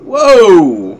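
loud, high startled cry. Real, not acted. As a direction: jump at a sudden enemy. Startled by enemy in game.

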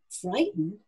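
plain reading voice

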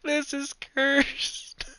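high, slow, drawn-out disbelief; incredulous. Real, not acted. Gamer seeing the rat in Colorado, drawn-out disbelief. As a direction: stretch each word as if horrified. Reacting to the cursed face.

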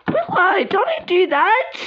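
high-pitched, drawn-out plea; high pleading. Acted. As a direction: beg her to stop in a squeaky voice. Pleading with friend in roleplay.

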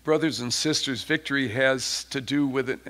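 normal talking voice

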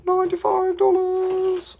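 high, squeaky sing-song price; squeaky price call. Pretend.

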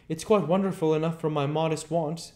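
high, loud character voice; impressed Marvel. A narrator's reading. Marvel answers the invisible man, high and impressed. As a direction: pitch it up, thoroughly impressed.

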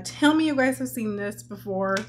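ordinary talk, nothing marked

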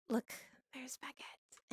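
slow, hushed whisper; soft voiceless aside. Real, not acted. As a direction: whisper it slowly so only the listener hears. Whispers on spotting Baguette.

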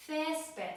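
Césped with a high first syllable; penultimate stress. Shown as a demonstration. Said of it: "akcent właśnie pada na".

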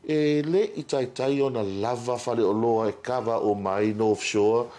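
ordinary talk

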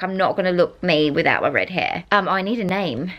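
ordinary delivery

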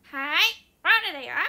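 loud, high, bubbly Rola greeting; Rola impression. Showing viewers her impression of the celebrity Rola, loud and bubbly. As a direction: chirp it out like the TV star Rola. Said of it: "ローラさんのモノマネできるんですよ".

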